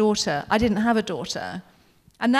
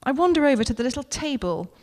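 plain reading voice

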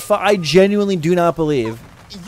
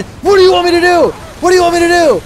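high loud desperate yell; exasperated shout; 14 semitones higher, 6 dB louder, faster than usual. Real, not acted. Streamer yelling at the game as the car takes damage, exasperated shout. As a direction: throw the question at the screen in fury.

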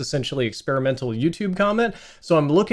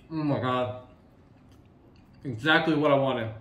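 talking with mouth open. Real, not acted. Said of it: "I'm talking with my mouth open".

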